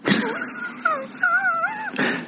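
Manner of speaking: shrieking laugh, almost crying; high squealing laugh. Real, not acted. Laughing at the nose test.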